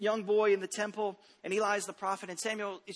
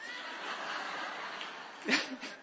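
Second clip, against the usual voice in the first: breathless silent laugh; airy laugh; 3 semitones higher, 7 dB quieter than usual. Real, not acted. Pastor laughing at his son's bedtime fear, airy laugh.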